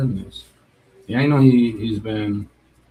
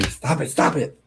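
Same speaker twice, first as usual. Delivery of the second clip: higher, mild mock scream; retold screaming; 5 semitones higher than usual. Classmate begging him to stop, mock screaming. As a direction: imitate a frightened classmate's scream. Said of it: "She's like, starts screaming".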